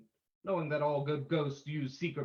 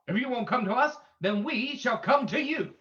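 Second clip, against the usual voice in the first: defiant challenge; 5 semitones higher, 5 dB louder than usual. Acted. Hero challenges the ghost.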